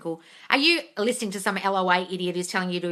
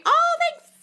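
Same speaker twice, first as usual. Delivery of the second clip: high squeal; 4 dB louder than usual. Real, not acted. Squeals thanks for compliment.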